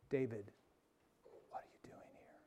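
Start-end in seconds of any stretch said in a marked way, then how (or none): 1.5-2.4 breathy unvoiced whisper, slowed; hushed whisper of the still small voice. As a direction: whisper it right to one person. Whispered to congregant David.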